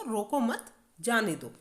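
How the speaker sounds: रोको मत joined, brief stop, then जाने दो; pause after मत. Shown as a demonstration. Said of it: "रोको मत के बाद थोड़ा सा रुके". (मत के बाद थोड़ा रुककर)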